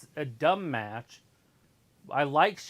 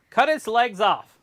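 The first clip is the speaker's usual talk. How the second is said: loud; 8 semitones higher, 11 dB louder, slower than usual. Real, not acted. Joking violent suggestion.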